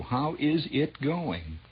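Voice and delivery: slow and deliberate, leaning on is; slow, pointed question. Shown as a demonstration. Host pressing the listener, slow pointed question. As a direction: ask it slowly like you really mean it. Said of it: "Well, I'm gonna ask you".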